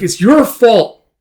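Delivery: high, loud, drawn-out blame; angry accusation. Acted. Casca blames Guts, loud accusation. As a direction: pin the blame on them, slowly and loudly.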